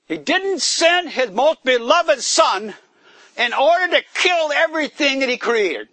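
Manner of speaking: rising sermon fervour; fervent preaching. Acted. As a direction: preach it out with full force to the congregation. Preaching at full force.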